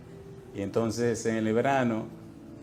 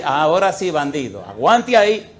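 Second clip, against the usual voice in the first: louder, teasing jab at the rascal; raised mock-stern voice; loud playful taunt; 8 dB louder than usual. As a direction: hammer it home like a mock threat. Preacher taunts converted man.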